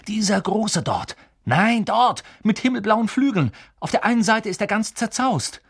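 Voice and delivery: high, loud, urgent calling. A narrator's reading. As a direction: shout directions across the room. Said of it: "rief er den anderen zu". (Harry ruft den anderen laut zu)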